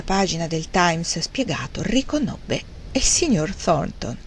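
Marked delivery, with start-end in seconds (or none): none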